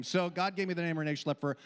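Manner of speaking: usual speaking voice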